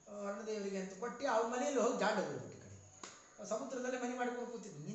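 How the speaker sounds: ordinary talk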